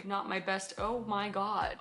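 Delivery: ordinary talk, nothing marked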